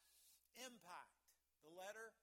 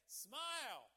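a loud prompt for the congregation to answer; loud cheerful cue; 4 semitones higher, 9 dB louder than usual. Shown as a demonstration. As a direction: call it out loud and bright. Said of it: "I'll say the smile part".

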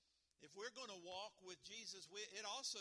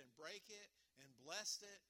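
ordinary talk

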